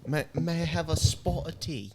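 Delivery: exaggerated British accent. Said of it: "that's just stereotypical".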